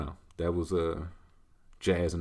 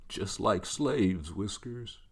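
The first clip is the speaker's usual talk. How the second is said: slow, drawn-out dog retort; talking dog; 4 dB quieter, much slower than usual. Acted. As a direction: answer as a grave talking pet, dropping soft at the end. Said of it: "That's how my dog talks".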